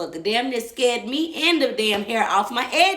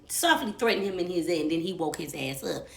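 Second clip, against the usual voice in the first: ordinary delivery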